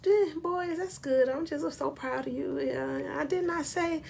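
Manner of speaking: very soft, timid praise, put on; small, hushed, passive voice; mock mousy voice. Shown as a demonstration. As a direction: imitate a meek parent who barely speaks up. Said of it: "could not have a voice with just".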